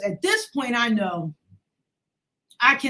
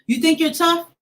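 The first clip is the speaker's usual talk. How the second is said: higher, louder menacing taunt; angry taunt; 9 semitones higher, 8 dB louder than usual. Retold or imitated. Uncle squaring up to his teenage niece, angry and loud. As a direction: throw the challenge in her face. Uncle confronting her angrily.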